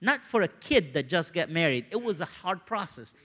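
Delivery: ordinary talk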